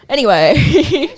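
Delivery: drawn-out laughing anyway. Real, not acted. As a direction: laugh it off and move on. Laughing change of subject.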